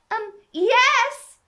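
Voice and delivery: hesitation, then a high loud shout; shouted answer. A narrator's reading. Alice answers the Queen, shouting. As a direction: shout back after a small um. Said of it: "shouted Alice".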